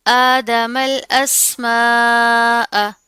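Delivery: slow Quran recitation, long madd vowels; drawn-out recitation. Shown as a demonstration. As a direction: stretch the vowels out as in tilawat. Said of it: "मद आ रही है तो इसको लंबा करके खींच कर पढ़ेंगे". (खींच कर लंबा पढ़ना)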